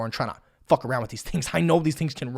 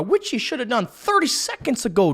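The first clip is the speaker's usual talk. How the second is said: ordinary delivery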